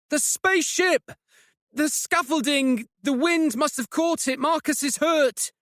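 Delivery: high, frantic shouting; panicked. Acted. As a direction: blurt it out in a panic. Panicked explanation over wind.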